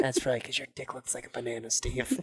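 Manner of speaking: soft, low menacing taunt; quiet menace. Retold or imitated. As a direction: taunt him softly. Boss quiet mockery.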